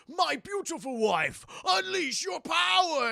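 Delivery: hammed-up villain, loud, high and slow; Shao Kahn. Shao Kahn commanding his wife, in a hammed-up slow bellow. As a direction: bellow a grand command, stretching each word.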